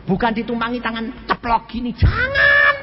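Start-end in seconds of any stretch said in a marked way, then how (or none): 1.9-2.8 loud, very high, drawn-out no; shouted warning. Acted. Pastor warning the husband, shouted warning. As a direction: stop him with a high shout.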